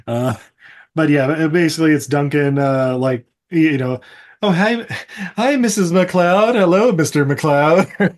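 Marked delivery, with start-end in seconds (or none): 4.4-8.2 slow, higher-pitched sing-out hello; hammed-up lovey greeting; cutesy mock greeting. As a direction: greet like mock-smitten newlyweds. Acted newlyweds greeting each other.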